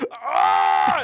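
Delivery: loud acted yell of pain; cramp yell. Rider whose legs both cramp, yelling out. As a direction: cry out loudly as the cramp hits. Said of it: "And I, I yell".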